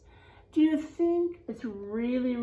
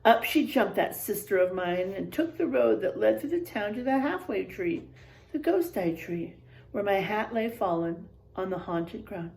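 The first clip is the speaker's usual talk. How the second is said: expressive storybook voice, quotes acted out; reading to children; 4 semitones lower than usual. A narrator's reading. Teacher reading a spooky picture book to the class, expressive. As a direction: read it like a spooky bedtime tale for kids.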